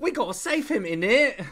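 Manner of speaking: loud, high-pitched comic British; mock British slang accent. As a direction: shout it like a cartoon Brit. British accent bit continues.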